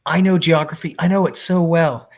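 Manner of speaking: loud, high, overconfident bragging; cocky boast. Retold or imitated. Buddy bragging about geography to the platoon, loud cocky boast. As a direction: brag like you can't be wrong. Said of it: "it was like".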